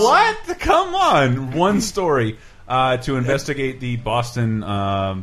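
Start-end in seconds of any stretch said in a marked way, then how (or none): 0.0-2.4 high, loud cry of disbelief; mock outrage. Writer scoffing at a year spent on one story, loudly incredulous. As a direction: exclaim incredulously, voice shooting way up.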